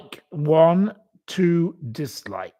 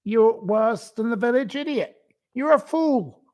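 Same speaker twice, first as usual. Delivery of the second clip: mock scold; 8 semitones higher than usual. Insulting her mockingly.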